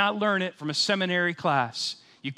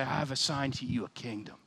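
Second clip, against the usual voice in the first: quieter and lower, like a hushed promise; soft reverent recital; 3 semitones lower, 6 dB quieter than usual. Shown as a demonstration. Pastor reciting the communion verse to the church, softly. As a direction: say it softly, as a gift received. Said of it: "each and every time we say".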